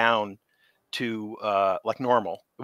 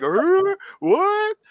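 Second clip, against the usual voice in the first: high, slow-witted monster grunt; dumb creature; 5 dB louder than usual. Retold or imitated. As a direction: play a big dim beast struggling to think. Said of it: "trying my best to".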